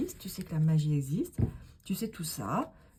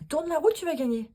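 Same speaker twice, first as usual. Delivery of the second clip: higher, louder, fast host call; mock game show host; 11 semitones higher, 5 dB louder, faster than usual. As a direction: sell it like a TV wheel host. (animateur télé qui s'emballe)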